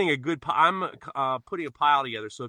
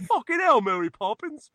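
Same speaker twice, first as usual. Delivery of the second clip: cockney imitation; 10 semitones higher than usual. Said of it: "[name] started going".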